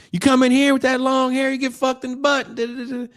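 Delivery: very high, loud hammed-up inmate yell; prisoner yelling. Prisoners threaten the long-haired kid, high and loud. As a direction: holler it high like a cartoon convict.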